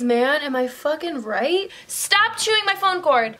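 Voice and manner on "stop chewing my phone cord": high, loud scolding; scolding dog. Real, not acted. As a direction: tell the dog off sharply.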